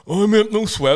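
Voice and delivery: loud, high mock speech impediment; cleft palate speech. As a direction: barman with a put-on cleft palate voice. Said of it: "So have I".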